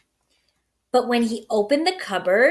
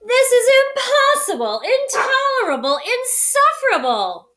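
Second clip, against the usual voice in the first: loud, high, building fury; angry cry; 13 semitones higher, 4 dB louder than usual. A narrator's reading. Bear fed up with the mouse, angry cry. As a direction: let the anger shake through each word. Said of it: "cried Bear, shaking with anger".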